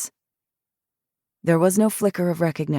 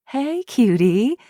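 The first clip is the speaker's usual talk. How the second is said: 1 cooing at dog; 7 semitones higher, 3 dB louder, slower than usual. A narrator's reading. Devin cooing at the beagle.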